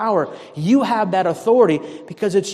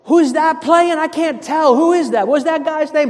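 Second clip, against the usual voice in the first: high, puzzled squint of a voice; mock confused fan from the top row; squinting from nosebleeds; 9 semitones higher than usual. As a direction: strain to see the players far below, pitched way up.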